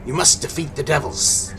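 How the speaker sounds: put-on old hag. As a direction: command them as a ghostly hag. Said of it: "hisses the hag's disembodied voice".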